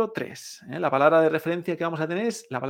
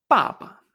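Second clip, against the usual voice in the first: "Papa" high and falling on the first syllable, short light second; fourth tone, then toneless; 5 semitones higher, 4 dB louder than usual. Shown as a demonstration. Teacher repeating Chinese for dad, "Papa" strong then toneless. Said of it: "el cuarto tono y el sin tono".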